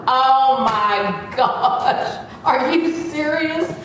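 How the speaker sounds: high, drawn-out dismay; laughing, exaggerated incredulity; mock appalled disbelief. Retold dismay at pat-down.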